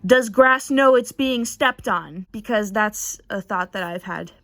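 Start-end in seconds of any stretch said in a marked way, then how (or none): 0.0-2.2 mock scream. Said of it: "who's screaming".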